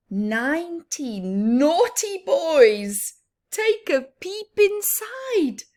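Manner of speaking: usual speaking voice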